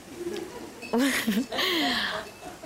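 high, soft burst of laughter. Real, not acted. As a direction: laugh at your own joke. Laughs at own Ferrari line.

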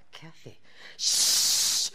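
unvoiced slow shush to a friend. Shushing Kathy in the dark class, unvoiced shush. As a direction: hush her, drawn out.

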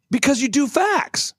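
high-pitched, emphatic retold revelation; excited friend. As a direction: imitate a friend proudly announcing his theory. Acted quote of excited friend.

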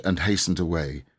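ordinary delivery

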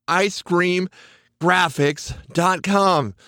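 slow, spelling it out word by word; careful slow web address; slow and clear. Shown as a demonstration. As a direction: say graphics dot com slowly so it sticks. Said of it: "and then the word scream, then graphics".

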